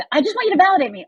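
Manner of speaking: high, quick mock whiny friend; complaining friend. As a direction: imitate the friend who just wants validation. Complaining friend answers again.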